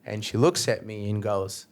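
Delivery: normal talking voice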